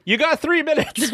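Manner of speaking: high-pitched mock alarm; squeaky imitated protest; retold Kermit interjection. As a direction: cut in high, laughing through it. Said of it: "And then he's like".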